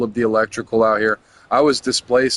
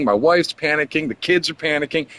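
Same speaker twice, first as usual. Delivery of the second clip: ordinary talk, nothing marked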